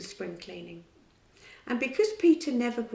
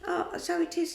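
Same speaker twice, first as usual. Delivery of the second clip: unconvinced; 9 semitones higher than usual. Acted. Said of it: "without much conviction".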